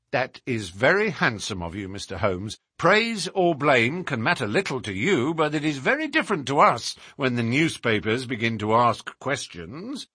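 animated inspector in story dialogue; police inspector. Acted. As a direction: voice an official conceding a point.

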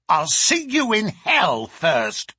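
slow, loud, spiteful refusal; angry defiance. Acted. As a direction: refuse with drawn-out venom. Servant refuses Holmes with defiance.

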